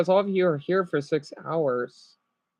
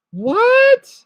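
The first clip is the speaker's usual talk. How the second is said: high, loud disbelieving what; shocked what; 8 dB louder than usual. Real, not acted. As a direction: react in sudden disbelief. Surprised by word meaning.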